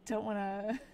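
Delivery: ordinary delivery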